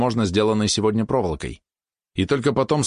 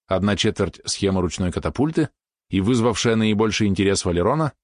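ordinary delivery

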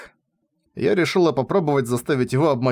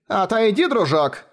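higher-pitched, mild shout; old lady's shout; 7 semitones higher than usual. A narrator's reading. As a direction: call out as a pushy old lady. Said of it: "крикнула пожилая дама".

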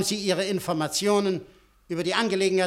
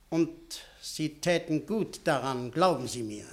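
quiet threat; 3 semitones lower, 7 dB quieter than usual. Acted. Veiled threat to reporter.

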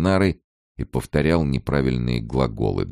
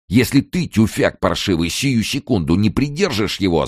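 raised, insulting threat; angry yell; 6 semitones higher than usual. A narrator's reading. Kern threatening the man below, angry yell. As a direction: lash out with a threat. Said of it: "заорал он".

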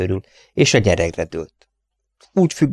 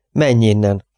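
plain reading voice

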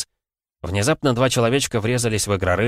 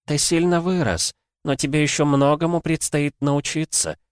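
ancient oracle voice; 9 semitones higher than usual. Acted. Oracle speaks on.

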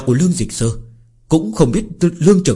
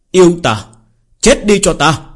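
battle shout; 5 semitones higher, 6 dB louder than usual. Acted. Hero attacks the demon.

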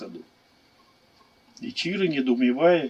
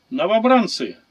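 loud, higher parade shout; shouted command; 4 semitones higher, 7 dB louder than usual. A narrator's reading. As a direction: call the recruits to attention. Centurion addresses recruits again.

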